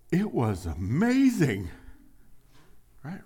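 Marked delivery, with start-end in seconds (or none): 0.1-1.7 awed reply. Acted. Acted churchgoer answers friend.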